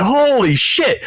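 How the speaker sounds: high, drawn-out amazement; retold jaw-dropped disbelief; stunned shock. Retelling shock at the snub.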